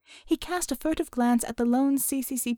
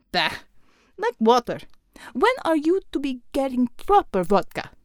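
put-on scornful Russian accent, a bit higher; 3 semitones higher, 3 dB louder than usual. Savior mocking Mel's weak vodka, scornful Russian accent. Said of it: "Savior asked scornfully".

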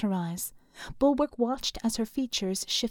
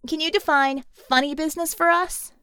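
raised, louder cheeky challenge; shouting into walkie-talkie; 5 semitones higher, 4 dB louder than usual. A narrator's reading. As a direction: call it out over the wind. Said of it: "shouting through a handheld walkie-talkie".

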